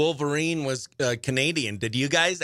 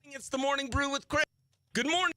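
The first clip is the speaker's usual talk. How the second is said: radio host intro; 8 semitones higher, 7 dB quieter, faster than usual. Acted. Replayed radio intro clip.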